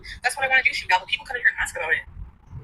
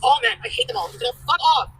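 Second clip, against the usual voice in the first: high, angry tirade at men; furious rant; 6 semitones higher than usual. Acted. Venting at her ex on stream, furious and high. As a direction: spit it out in disgust.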